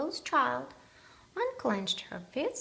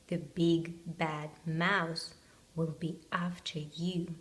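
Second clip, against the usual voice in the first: spooky whisper; 4 semitones lower than usual. Acted. Gruffalo warns child, spooky.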